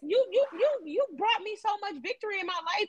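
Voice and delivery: high, pleading testimony to God; impassioned confession. Acted. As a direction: pour out gratitude turning to remorse. Voices David's remorseful prayer to God.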